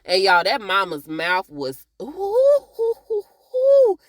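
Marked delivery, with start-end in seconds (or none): 2.0-4.0 squealed high loud ooh ooh; high ooh exclamations. Real, not acted. Vlogger reacting to the mama's sharp words with high loud oohs. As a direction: shriek ooh like something stung. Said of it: "That mama's mouth was sharp".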